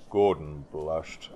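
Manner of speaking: usual speaking voice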